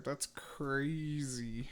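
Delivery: slow, breathless whisper of awe. Real, not acted. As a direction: hush it out slowly, stunned. Streamer reacts to clip, whispered.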